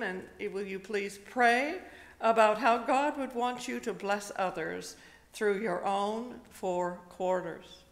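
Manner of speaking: normal talking voice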